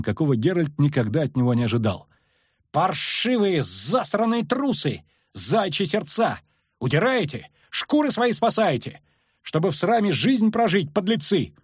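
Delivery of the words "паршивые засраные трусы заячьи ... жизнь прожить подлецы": high, scathing tirade; roared abuse. A narrator's reading. Lyutik shames the fleeing soldiers, roaring. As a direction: hurl insults at the cowards. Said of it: "рявкнул влезающий на мост Лютик таким голосом".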